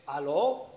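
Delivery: Spanish "Aló?" with a rising question; Colombian phone greeting. Shown as a demonstration. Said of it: "you say".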